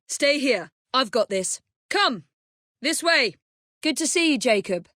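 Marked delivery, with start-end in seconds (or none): none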